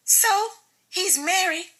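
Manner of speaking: high mocking female voice; Michelle's voice. Wife doubting her husband's friend, high and mocking. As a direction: pitch it way up, scornful. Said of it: "Jamar said defensively".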